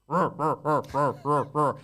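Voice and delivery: rolling mock growl of a creature; monster growl. Describing the alien boss, mock monster growl. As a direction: growl it out like a beast. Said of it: "like that, and then his massive tendrils".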